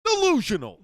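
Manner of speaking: very high, loud outburst; mock catchphrase yell. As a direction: yell it as a hammed-up retake. Mock take forty-two of yelled catchphrase.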